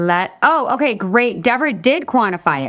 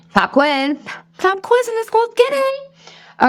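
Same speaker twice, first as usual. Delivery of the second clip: much higher, bright game-show shout; playful pop quiz call; slower than usual. Real, not acted. Coach calling viewers into a pop quiz, high playful cry. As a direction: announce it like a gleeful quizmaster.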